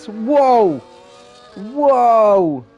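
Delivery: loud, drawn-out whoa; amazed whoa. Real, not acted. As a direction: gasp it out in amazement. Amazed at lap time.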